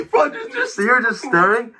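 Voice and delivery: high, cracking laughter through the words; can barely get it out for laughing; laughing hard. Real, not acted. Streamer laughing at a stranger who just stared, cracking up.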